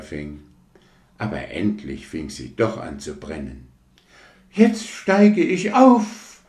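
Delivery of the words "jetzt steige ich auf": sehr hoch, laut und langsam geschrien; Schrei. Acted. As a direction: schrei es stolz in die Höhe. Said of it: "schrie sie". (Rakete schreit triumphierend beim Aufsteigen)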